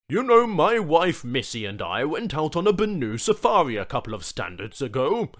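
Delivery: a corporate man making small talk; chatty businessman. Acted. The suit's voice in dialogue.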